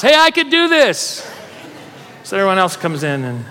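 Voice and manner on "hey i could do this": loud and high "Hey, I could do this" declaration; real loud. Shown as a demonstration. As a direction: call it out loud so the house hears. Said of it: "say real loud in your house".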